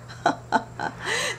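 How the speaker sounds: airy laugh up high; breathy high giggle. Real, not acted. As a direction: giggle at your own joke. After her joke.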